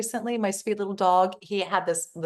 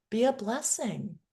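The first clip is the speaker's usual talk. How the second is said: ordinary delivery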